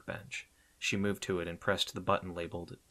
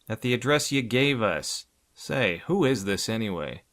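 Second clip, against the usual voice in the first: suspicious; 3 semitones higher, 7 dB louder than usual. A narrator's reading. Suspicious gangster on phone.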